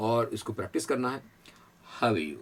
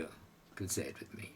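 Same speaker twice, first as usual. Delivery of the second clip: soft aside; 8 semitones lower, 16 dB quieter, much slower than usual. Real, not acted.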